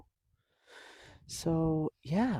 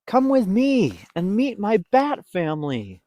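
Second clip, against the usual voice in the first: bright raised character voice; eager invitation; 7 semitones higher, 8 dB louder than usual. Acted. Stellaluna inviting the birds, eager. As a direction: coax your friends along.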